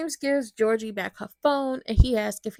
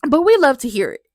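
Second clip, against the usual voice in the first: excited; 3 semitones higher, 11 dB louder than usual. Real, not acted. Gleeful reaction to a scene.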